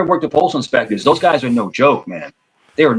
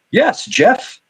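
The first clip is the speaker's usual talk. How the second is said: higher, polished on-air voice; a reporter on camera; news reporter voice; 9 semitones higher, slower than usual. Retold or imitated. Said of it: "news reporters".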